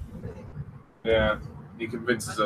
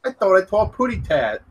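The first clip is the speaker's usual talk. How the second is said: high cartoon bird voice; Tweety Pie; 8 semitones higher than usual. Co-host mocks the pet name as a cartoon bird, high lisping voice. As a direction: put on a high cartoon canary lisp. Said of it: "Tweety Pie".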